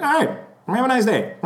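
high-pitched imitation of brusque goodbye; Southerner. Celebrity after shaking fan's hand, brusque Southerner. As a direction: dismiss the fan in a put-on Southern voice. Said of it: "he's, like, a straight up Southerner".

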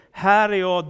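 loud answering call. Acted. Samuel comes to Eli a third time, calling out.

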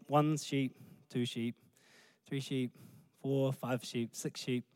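low, soft, drowsy sheep count; murmured bedtime counting; sleepy counting. Acted. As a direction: count quietly as if nodding off. Counting sheep to fall asleep.

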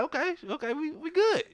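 high-pitched relieved "okay, okay". Retold or imitated. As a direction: squeak it out, relieved and eager. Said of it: "I'm like".

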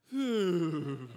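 a big high loud breathy phew; mock relieved phew. Retelling the friend's reaction, a loud mock phew. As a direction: blow out a hammed-up phew of relief. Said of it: "And then Nate's like".

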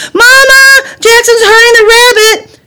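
very high, loud cry to mom; tattling scream. Acted. Sister telling mama on her brother, screaming. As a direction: yell for help in outrage. Girl yells to mother for help.

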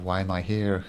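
ordinary talk

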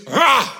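loud high snarl at the tomb. Retold or imitated. Jesus at Lazarus' tomb, loud snarling roar. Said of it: "Jesus is weeping and snarling".

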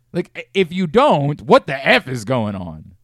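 exasperated rant. Real, not acted. Ranting about team decision.

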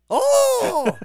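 loud high hammed-up yelp; mock impression cry. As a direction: shriek it like a caricature. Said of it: "I'm impersonating someone".

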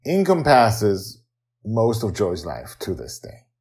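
usual speaking voice

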